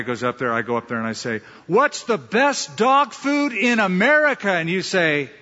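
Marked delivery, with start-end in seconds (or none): 1.7-4.5 high, loud rallying cry, drawn out; crowd pumping. Acted. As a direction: fire up a room of salespeople. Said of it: "he's pumping up the crowd".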